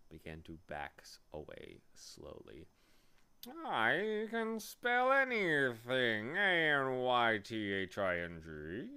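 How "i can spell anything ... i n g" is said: slow, high, swooping bee voice; boastful bee. A narrator's reading. Bee boasting of spelling to Milo, slow swooping brag. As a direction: show off with stretched-out letters. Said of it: "he boasted".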